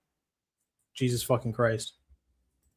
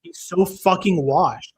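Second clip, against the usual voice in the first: slow, higher and louder disbelief; exasperated rant; 5 semitones higher, 5 dB louder, slower than usual. Real, not acted. Streamer ranting to chat about the fighter, slow exasperated. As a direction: lean hard on every word in disbelief.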